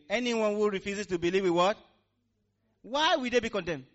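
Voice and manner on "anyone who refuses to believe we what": higher, louder call to the congregation; louder retake. Shown as a demonstration. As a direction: say it again with more force. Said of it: "Let's go again".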